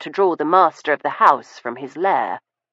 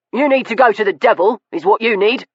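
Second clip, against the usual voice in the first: fast, higher, scornful comeback; angry retort; 5 semitones higher, faster than usual. Acted. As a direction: snap it back quickly.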